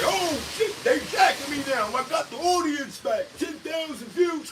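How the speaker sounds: high, loud gloating yell; mock excitement. Streamer crowing over numbers, mock excitement. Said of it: "didn't make you say".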